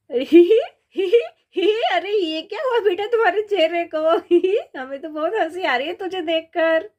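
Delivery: loud giggles breaking into the words; laughing hard. Acted. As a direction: laugh at the sight of his face. हमें तो बहुत हंसी आ रही है. (हँसते हुए)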